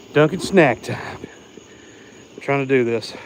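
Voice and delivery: winded and puffing between words; out of breath. Real, not acted. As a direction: talk while catching your breath. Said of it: "I am out of breath".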